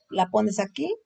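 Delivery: normal talking voice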